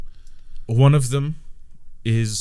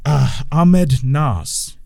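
slow, loud and higher announcement; grand name; 5 semitones higher, 8 dB louder, much slower than usual. Acted. Sheep wizard proudly names himself, slow and loud. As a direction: proclaim your name slowly.

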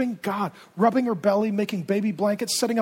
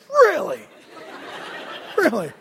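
mock disbelief; 8 semitones higher than usual. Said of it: "the angel's like".

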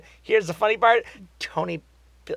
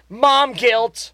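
exclaimed; 6 semitones higher, 7 dB louder than usual. Real, not acted. Punchline exclaimed.

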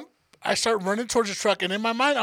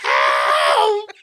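long, loud mock scream for help; hammed-up bellow for rescue; retold scream; 7 dB louder than usual. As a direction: drag the yell out at full force. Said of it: "screaming at the top of my lung".

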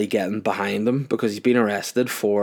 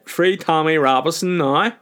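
raised put-on chanting voice; mock banner slogan; 6 semitones higher, 4 dB louder than usual. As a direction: mockingly proclaim the slogan, pitched up. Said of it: "It said".

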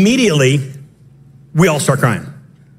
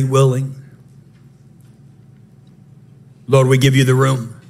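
prayer leading; 5 semitones lower than usual. Real, not acted. Leads congregation into prayer.

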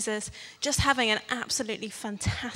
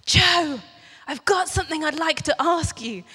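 higher, louder imitation of a young girl; mock conspiratorial; 5 semitones higher, 5 dB louder than usual. Eleven-year-old girl approaching her camp leader, mock conspiratorial. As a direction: put on a girl's eager, secretive approach. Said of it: "come up to you conspiratorially and say".

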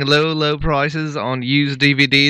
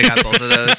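higher, strained attempt at an Australian accent; overdone Australian voice, higher; put-on Australian; 8 semitones higher than usual. Shown as a demonstration. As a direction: force the Australian accent back in, pitched up. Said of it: "I can't even talk Australian about this".

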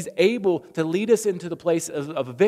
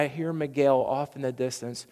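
normal talking voice

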